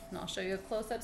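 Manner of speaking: plain reading voice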